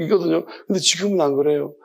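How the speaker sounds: normal talking voice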